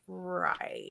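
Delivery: soft, drawn-out doubtful reply; imitating a dubious husband; skeptical husband. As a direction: draw it out quietly, humoring someone.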